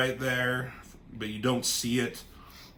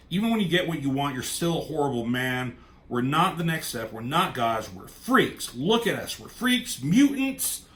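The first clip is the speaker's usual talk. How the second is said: mounting anger; 3 semitones higher than usual. Retold or imitated. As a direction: build to a furious cry at her father. Wanda raging at Magneto.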